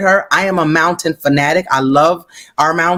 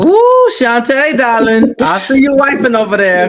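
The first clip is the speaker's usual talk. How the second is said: delighted call-out; 7 semitones higher, 3 dB louder than usual. Real, not acted. Host calls out weeping friend.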